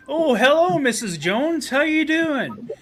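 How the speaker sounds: high, loud sing-song greeting; John Candy's food repairman. Retold or imitated. Food repairman greeting Missus Jones, high hammed-up voice. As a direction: play a hammed-up repairman hailing a customer. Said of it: "John Candy as the food repairman".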